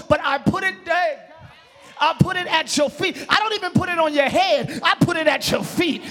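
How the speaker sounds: emphatic, building preacher's cadence; fervent preaching. Real, not acted. Preacher laying his crown at the king's feet, fervent preaching. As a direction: declare humble surrender with full conviction.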